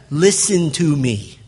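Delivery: slow, deliberate "Listen to me"; emphatic. Acted. Parent getting a small child's attention, "Listen to me" slow and emphatic. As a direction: spell out "Listen to me" firmly, unhurried. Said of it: "It's emphatic".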